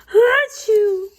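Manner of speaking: loud acted achoo; sneeze. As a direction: burst into a sneeze.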